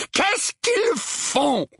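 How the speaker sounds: very slow, high-pitched demand; furious. A narrator's reading. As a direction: stretch each word in fury. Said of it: "demanda l'oncle Vernon avec fureur". (fureur aiguë, mots étirés)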